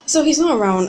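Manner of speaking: so he's not around, incredulous and loud; exasperated disbelief. Retold or imitated. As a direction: let the bad news land hard. Retold exasperation at absent uncle.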